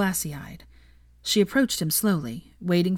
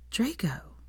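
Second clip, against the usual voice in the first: whispered name; tender whispered Draco; breathed; 5 semitones higher, 6 dB quieter than usual. A narrator's reading. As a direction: breathe his name through tears. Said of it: "she breathed".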